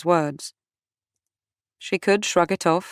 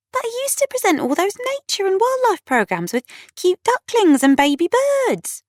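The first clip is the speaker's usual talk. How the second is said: very high-pitched, incredulous gushing; protesting. A narrator's reading. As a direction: object in squeaky disbelief. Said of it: "Kat protested".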